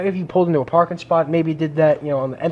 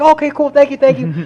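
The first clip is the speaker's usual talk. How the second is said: imitating the caller; 8 semitones higher, 5 dB louder than usual. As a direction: play the phone owner pitched up high. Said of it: "And she's just like".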